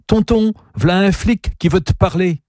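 put-on high, bright childish announcing; cheerful announcement. Girl gleefully tells her uncle a cop is here. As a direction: chirp it out like a delighted child. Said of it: "s'entendit annoncer gaiement".

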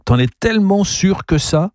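high, pressing question; insistent challenge. A narrator's reading. Gabriel challenging Charles over the monument, insistent. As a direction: press them to doubt themselves.

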